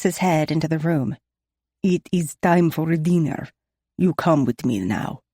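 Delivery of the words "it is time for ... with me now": Nikolai's heavy Russian accent, lightly voiced; a hint of thick Russian vowels. A narrator's reading. Nikolai calling Talia to dinner, light Russian accent. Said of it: "he tells me in his heavy Russian accent".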